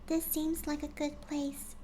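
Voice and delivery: high, soft, slow gentle pony voice; quiet, gentle Fluttershy. Acted. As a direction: speak softly as timid Fluttershy. Fluttershy voiced in fanfic reading.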